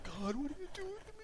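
high, soft, whining plea; whiny complaint to God. Retold or imitated. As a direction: whine it up to heaven like a sulking child. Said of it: "and I'm like".

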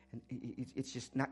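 usual speaking voice

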